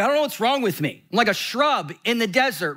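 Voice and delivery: hammed-up moping about yourself; mock self-pity. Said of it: "on Mondays, I'm like".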